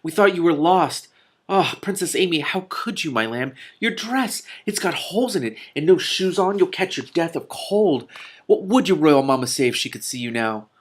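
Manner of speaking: high, breathless scolding nurse; old nurse scolding the princess in relief; fussing old nurse. A narrator's reading. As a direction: fuss and cluck over her lamb. Nurse fussing over lost princess.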